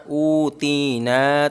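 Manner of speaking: very slow ऊ ती ना, each long vowel held equally; slow drawn-out recitation; all vowels stretched. Shown as a demonstration. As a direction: hold ऊ, ती and ना evenly long. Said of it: "तीनों को बराबर खींचेंगे".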